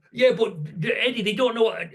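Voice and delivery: put-on a cocky young lad arguing back at his boss; indignant protest. Young him protests to foreman.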